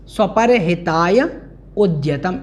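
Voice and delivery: स्वपर हिताय held, break, then उद्यतम; sandhi split. Shown as a demonstration. Teacher repeating the split for students, separated हिताय, उद्यतम. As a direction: keep हिताय and उद्यतम apart with a short pause. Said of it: "इस प्रकार से".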